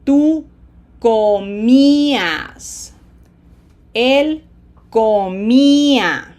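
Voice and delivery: loud, slow, each syllable stretched; Spanish repeat-after-me drill, slow and loud. Shown as a demonstration. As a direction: model each form slowly for repeating. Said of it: "Repeat after me slowly".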